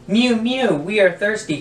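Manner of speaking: high mewing cat chorus, pleading; crying cats. Acted. Thirsty cats begging the old man, high mewing. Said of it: "cried the hundreds of cats".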